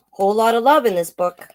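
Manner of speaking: plain reading voice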